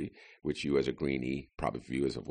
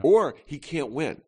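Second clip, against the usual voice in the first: mock voter excuse; 10 semitones higher, 5 dB louder than usual. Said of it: "That's my absolute favorite".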